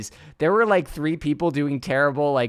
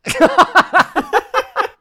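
big high burst of laughter; loud laugh; 10 dB louder than usual. Real, not acted. Laughing at the co-host's jab.

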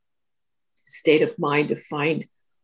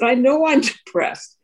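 laughing admission; 7 semitones higher, 4 dB louder than usual. Retold or imitated. Admits depression, laughing.

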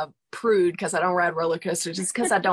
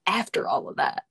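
whispering; 3 semitones higher than usual. Real, not acted. Measured whisper.

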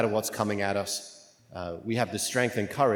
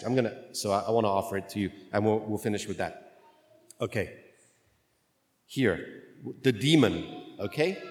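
ordinary delivery

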